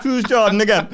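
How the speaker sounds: put-on lightly Jamaican-accented girl, laughing; a hint of Jamaican accent, laughing; Jamaican accent imitation. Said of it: "this Jamaican girl".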